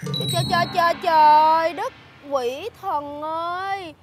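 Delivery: loud anguished cry to heaven, slowing at the end; wailing shock. Acted. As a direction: lament in disbelief. Thảng thốt kêu trời. (kêu trời thảng thốt)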